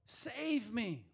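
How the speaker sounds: loud, high cry, easing on save me; cry for help. Retold or imitated. Sinking Peter crying out to the Lord, loud and high. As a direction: call out as if sinking. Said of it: "he cried out".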